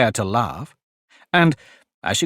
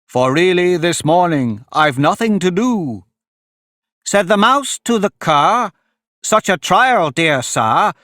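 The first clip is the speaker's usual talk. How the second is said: higher, declaimed rhyming verse; emphatic verse recitation; 6 semitones higher, 3 dB louder, slower than usual. Acted. Fury threatens the mouse with a trial, declaimed in verse. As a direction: declaim it like a relentless prosecutor.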